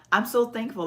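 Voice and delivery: normal talking voice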